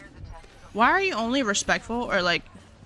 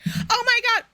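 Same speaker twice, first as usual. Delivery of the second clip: high, squealy delight; mock excited squeal; 4 dB louder than usual. Recalling buying the gummies, a high excited squeal. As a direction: squeal it like a thrilled kid. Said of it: "I was like".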